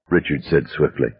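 ordinary delivery

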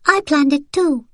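put-on high boy's voice, chest puffed out; a small boy wanting credit too; proud little boy. A narrator's reading. Little son to his mother, high boastful child voice. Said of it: "Daniel said, puffing out his little chest".

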